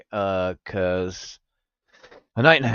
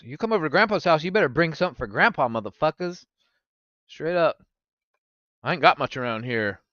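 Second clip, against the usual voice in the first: ordinary talk, nothing marked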